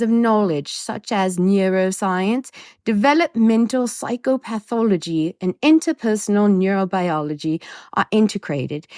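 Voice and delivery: lightly put-on English accent, reading a blurb; quoting the professor in a light English accent. Podcaster reads an expert's praise in an English accent. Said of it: "I'm gonna do an English accent for his quote".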